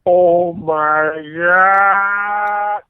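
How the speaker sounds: very slow, drawn-out stunned gasp; stunned awe. Retold or imitated. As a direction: stretch it out in dumbstruck amazement. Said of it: "making the O face".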